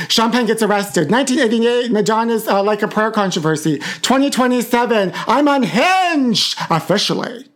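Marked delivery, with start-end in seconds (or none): 4.0-7.5 unhinged wail. Acted. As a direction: stretch it out high and theatrically. Mock announcement, stretched out high.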